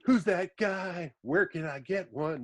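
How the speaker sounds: high, slow mock swoon from the girls. Girls watching him ride his motorcycle, a mock swoon. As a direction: imitate a girl sighing over the cute guy. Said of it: "all the girls".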